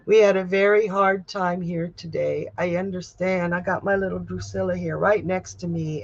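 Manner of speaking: normal talking voice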